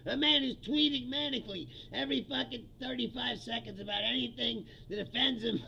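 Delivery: usual speaking voice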